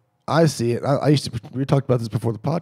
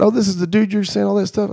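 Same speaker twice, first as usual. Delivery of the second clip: raised, higher confrontational call-out; calling him out; 9 semitones higher, 4 dB louder than usual. Retold or imitated. Calling out the roommate's buddy in front of everyone, raised and pointed. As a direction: put him on the spot out loud.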